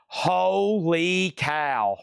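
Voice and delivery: drawn-out, very slow "holy cow"; amazed exclamation. Real, not acted. Seller to viewers at the next crate, slowly amazed. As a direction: stretch out the amazement.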